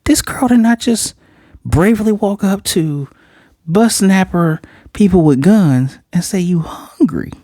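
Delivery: put-on two kids marvelling at the girl, trailing into a whisper; amazed kids. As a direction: marvel in disbelief, then hush. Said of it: "these two here like".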